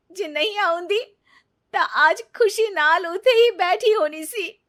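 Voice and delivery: high, loud, breaking outburst; anguished regret. Acted. Neeta lamenting to Ravi that she left her in-laws, anguished. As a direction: pour out betrayal and regret.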